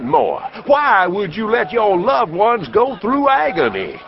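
high, booming medicine show pitch; snake-oil salesman. Acted. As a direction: sell it hard to the crowd like a huckster. Traveling professor sells his elixir.

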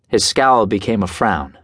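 ordinary delivery

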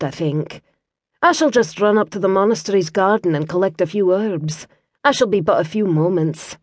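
soft Scottish woman's voice; Scottish lass. Acted. Kira warns the injured Liam in a soft Scottish accent. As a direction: tend him with a gentle Scots lilt.

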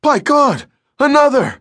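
high, loud, excited cry from aloft; hoarse excited shout. Acted. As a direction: shout it out, thrilled. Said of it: "hoarse with excitement".

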